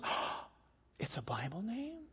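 gasp, then very hushed question; awestruck little boy. Retold or imitated. Boy learning about his own name, hushed awe. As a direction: imitate a child's breathless amazement.

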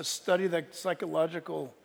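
ordinary talk

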